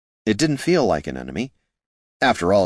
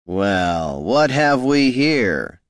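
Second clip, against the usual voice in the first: a slow, drawn-out leering bandit voice; higher, slow, sly highwayman; 4 semitones higher, much slower than usual. A narrator's reading. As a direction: savour the find like a grinning thug. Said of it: "said the first man".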